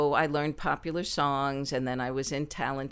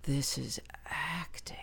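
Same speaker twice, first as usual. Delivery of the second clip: slow, hushed whisper; whispered in quiet wonder; awed whisper; 7 dB quieter than usual. Retold or imitated. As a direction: breathe it like a discovery. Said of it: "I felt".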